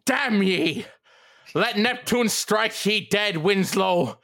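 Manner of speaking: high, slow roaring curse; furious scream. Acted. Old keeper cursing Winslow at full scream. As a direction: bellow it at Winslow like a madman. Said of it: "The scream was so loud".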